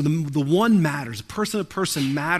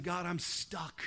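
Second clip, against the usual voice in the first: quiet prayer; 4 semitones lower, 12 dB quieter than usual. Retold or imitated. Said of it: "start crying out to him saying".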